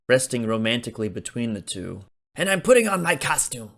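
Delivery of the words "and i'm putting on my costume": higher eager call; eager Leonard. A narrator's reading. Leonard insists on coming along, eager. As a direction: call out to join in.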